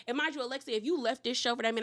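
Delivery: ordinary delivery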